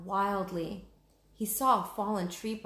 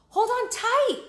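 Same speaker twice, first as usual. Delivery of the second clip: much higher, louder cry; urgent shout; 9 dB louder than usual. Acted. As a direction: shout a warning as danger nears. Wildebeest coming, Jack urges.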